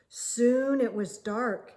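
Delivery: ordinary delivery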